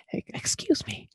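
voiceless, polite interruption; whisper. Acted. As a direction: whisper politely straight after something aggressive. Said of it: "do something super aggressive and whisper".

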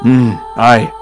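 usual speaking voice

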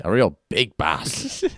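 put-on slow, drawn-out Aussie accent; exaggerated Aussie. Teasing co-host for sounding so Aussie, exaggerated Aussie accent. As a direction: stretch it out in broad Australian. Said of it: "That was so Aussie".

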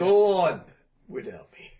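loud then fading dying plea; overacted death scene. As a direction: start loud, trail off dying. Mock death scene repeated.

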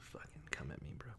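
breathless whisper taunt. Real, not acted. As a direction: hiss the challenge under your breath. Mock challenge in whisper.

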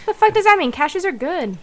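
loud, high outraged protest; indignant outrage. Real, not acted. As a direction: shout it in mock outrage. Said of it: "get a rise out of you".

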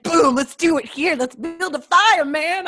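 hamming up a carefree bro; mock bro voice. Imitating friends at campfire.

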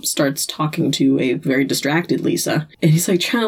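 ordinary talk

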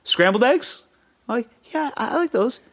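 high mock voices for dad and kid; mimicked exchange. Friend's dad refusing each breakfast order, high mock voice. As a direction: trade lines high and quick.

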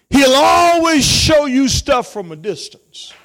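high-pitched, driving pulpit delivery; impassioned preaching. Real, not acted. Pastor warning the congregation about Satan's illusions, impassioned. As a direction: preach it high, stretching the key words.

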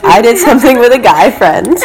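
reading a message through stifled laughter; amused retelling. Retold or imitated. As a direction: quote him, starting to crack up. Retelling a surprising confession, amused.

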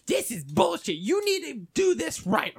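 high, indignant imitation; mock angry customer. Customer after a second mistake, high mock-angry complaint. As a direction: imitate a customer suddenly turning nasty. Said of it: "she does a one eighty".

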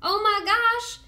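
high, loud burst of delight; squealing with delight; excited gush. Real, not acted. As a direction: gush like you just heard great news. Gushing over frog news.

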